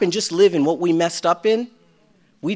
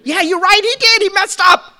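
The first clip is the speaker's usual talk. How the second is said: gospel's voice; 8 dB louder than usual. Retold or imitated. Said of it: "The gospel needs to preach to your story".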